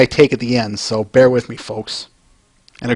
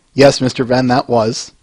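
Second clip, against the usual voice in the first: ordinary talk, nothing marked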